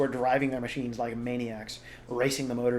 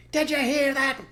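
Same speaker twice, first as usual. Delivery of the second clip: high, loud taunting call; yelled into the hole; 7 dB louder than usual. Acted. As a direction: shout down the hole. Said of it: "yelled Bean".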